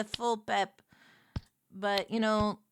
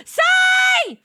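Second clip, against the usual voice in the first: excited shout; 14 dB louder than usual. Real, not acted. Excited greeting of a raider.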